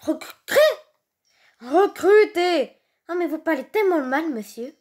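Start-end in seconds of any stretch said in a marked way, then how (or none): none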